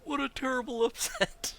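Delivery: loud, very high put-on announcer voice; Grogan impression. Gamer mimicking Grogan on the Stormreach team, loud high impression. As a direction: call it out like a squeaky-high arena commentator. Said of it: "That's my Grogan impression".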